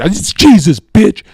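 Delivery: loud, high mock snap; angry shout recreating the debate; retold angry retort. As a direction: fire it back in the heat of the argument.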